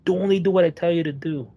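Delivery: retold yelling. Amped-up cop ordering a driver out, mock yelling. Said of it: "he's, like, yelling".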